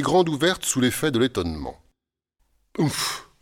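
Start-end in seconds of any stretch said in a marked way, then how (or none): 2.8-3.3 unvoiced puff of breath; muffled oomph. A narrator's reading. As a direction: choke out a stifled oof. Magician's mouth suddenly stuffed full.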